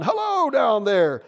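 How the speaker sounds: loud, high, stretched-out call; hollering down. A narrator's reading. Voice in the tree answers the bunny below, hollering. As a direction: holler slowly down from the treetop. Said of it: "hollers down".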